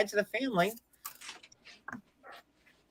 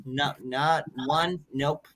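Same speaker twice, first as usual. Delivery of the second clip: ordinary delivery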